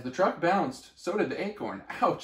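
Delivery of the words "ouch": ouch. Acted. Bounced in truck.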